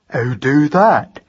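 slow, puzzled simple lad; big dim boy's drawn-out question; slow-witted son. Acted. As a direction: ask it laboriously, not understanding. Slow son asks how.

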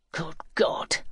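hushed, stunned exclamation; near-whispered shock at the demand. Acted. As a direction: breathe it out softly in disbelief. Shocked reaction to the sum demanded.

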